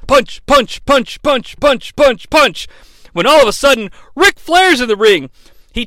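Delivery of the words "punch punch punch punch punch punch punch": rhythmic punch count. Retold or imitated. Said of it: "it goes like this".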